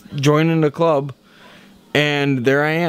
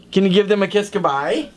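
normal talking voice

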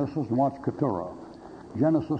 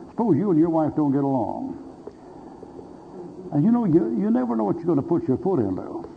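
pointed hypothetical; 4 semitones higher than usual. Retold or imitated. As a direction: lean in and set the trap. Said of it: "I said".

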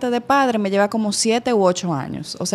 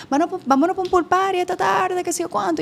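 friends inviting; 9 semitones higher than usual. Retold or imitated. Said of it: "Mis amiguitas me decían".

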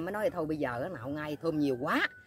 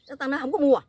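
higher, louder retold rebuff; annoyed refusal; 9 semitones higher, 8 dB louder than usual. Customer turning down a young fish seller, annoyed. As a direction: act out an irritated customer, raised voice.

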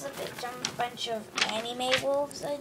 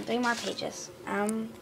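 ordinary talk

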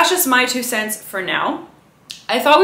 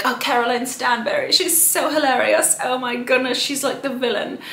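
higher-pitched mock British gushing; British accent; 4 semitones higher than usual. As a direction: put on an amateur British accent for a friend. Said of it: "That's, like, my British accent".

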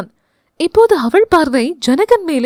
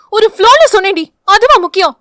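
high, loud snapping back; defensive shout; 8 semitones higher, 6 dB louder, faster than usual. Acted. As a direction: cover the slip loudly. (மாட்டிக்கொண்டு கத்துதல்)

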